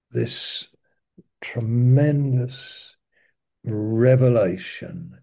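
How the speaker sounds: "tremendous revelation" drawn out slow, each word weighted; slow emphasis. Shown as a demonstration. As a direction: lean on "tremendous" and "revelation" slowly. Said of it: "I'm wanting to emphasize the word revelation".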